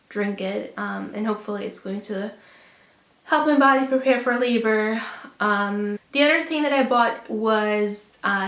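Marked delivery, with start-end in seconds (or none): none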